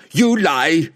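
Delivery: high-pitched angry roar. A narrator's reading. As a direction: roar the accusation, cutting in. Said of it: "Wulfgar roared".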